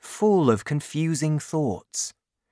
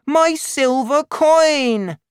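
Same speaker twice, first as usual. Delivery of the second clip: very high, loud, slow cry; child's wail; 7 dB louder, slower than usual. A narrator's reading. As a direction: wail for it like a small boy. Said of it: "cried the child".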